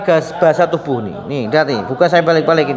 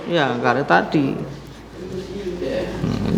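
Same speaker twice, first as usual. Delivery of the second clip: usual speaking voice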